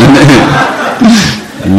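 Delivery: high delighted laugh; proud laugh. Real, not acted. Monk recalling his teacher's praise, laughing high. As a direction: laugh out loud with pride.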